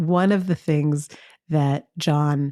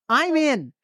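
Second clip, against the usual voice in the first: eager; 8 semitones higher than usual. Retold or imitated. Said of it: "And I was like".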